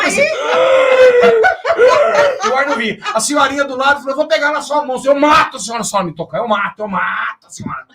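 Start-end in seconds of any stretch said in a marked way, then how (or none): 0.0-0.3 panicked shout. Retold or imitated. Panic on plane retold. 0.3-2.5 loud high panic scream, half laughing; panicked scream. Retold or imitated. 4.1-7.8 loud, high, panicked threat; frantic threat. Retold or imitated.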